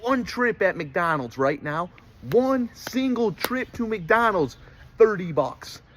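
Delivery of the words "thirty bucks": ordinary delivery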